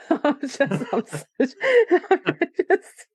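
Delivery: high, breathless giggling through the words; helpless laughter. Real, not acted. Host cracking up at guest's age remark, laughing. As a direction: try to apologise while laughing.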